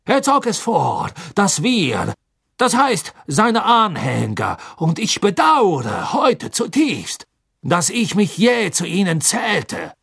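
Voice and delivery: hoch, gedehnt beteuernd; nervöser Karkaroff. Acted. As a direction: reuig bitten und beschwichtigen. Karkaroff rechtfertigt sich. (Karkaroff beteuert dem Gericht seine Reue)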